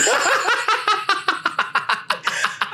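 high, airy laugh; high burst of laughter. Real, not acted. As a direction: let out a big laugh. Laughs at own story.

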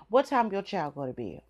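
ordinary talk, nothing marked

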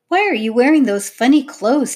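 high, loud, rude demand. A narrator's reading. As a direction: challenge the strangers bluntly. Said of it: "demanded Sophie".

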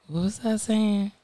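unhurried, softened question; too slow. Real, not acted. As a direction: drag it out slowly and softly. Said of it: "That was too slow".

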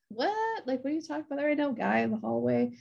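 a hint of a startled mother; baffled mom. Mom answering her four-year-old about the hallway man, baffled.

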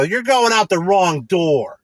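hammed-up employee correcting the customer; mocking employee. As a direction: imitate the worker's rebuke, slowed. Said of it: "and I go".

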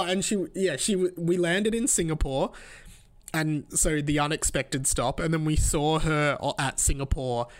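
normal talking voice

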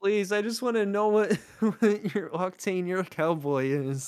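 laughing tease. Real, not acted. Teasing high voice with laugh.

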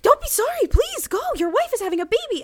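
very high, eager squeal of encouragement; overjoyed urging. Retold or imitated. Imitating Mandy Patinkin sending the new dad off, high and fast. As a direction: push him out the door with glee. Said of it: "He's like".

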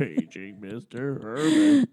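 slow PA announcer voice, repeated; slow intercom page from Pee-wee movie. Retold or imitated. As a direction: page him again over the speaker. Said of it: "all I can think in my head is".

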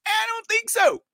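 squeaky high sarcastic no-way; high-pitched mock dismissal; mocking refusal. Mocking refusal to visit Fort Worth.

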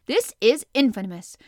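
loud furious outburst; Ned bursts into a rage; angry cry. Acted. Ned raging at the strangers who left, loud angry cry. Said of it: "cried Ned".

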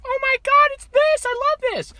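put-on very high childlike squeal of delight; excited scream. Speaker acting out childhood video of opening presents, excited screaming. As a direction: imitate a thrilled kid's high scream. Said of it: "I scream, and I'm like".

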